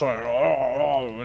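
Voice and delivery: loud, drawn-out mock dad yell; imitating dad screaming. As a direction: roar slowly, mimicking a screaming dad. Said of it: "his dad sounds like this".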